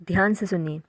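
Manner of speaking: ordinary delivery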